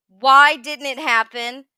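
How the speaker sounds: ordinary talk, nothing marked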